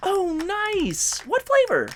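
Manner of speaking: high, delighted squeal; excited. Acted. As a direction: gush with sudden delight. Delighted gasp about the cake.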